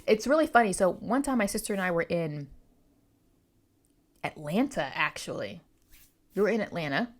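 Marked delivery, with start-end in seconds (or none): none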